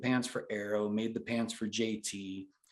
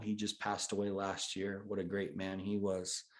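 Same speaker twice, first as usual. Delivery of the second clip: ordinary talk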